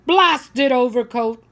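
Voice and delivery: loud, slow grumpy complaint; grumpy old man. Acted. Old man fighting his overcoat, loud and slow. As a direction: grumble it like a fed-up old man.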